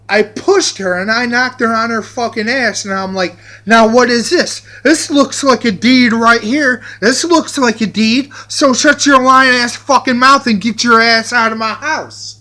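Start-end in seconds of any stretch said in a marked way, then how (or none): 3.7-12.3 loud triumphant yell. Retold or imitated. Yelling at mom with deed.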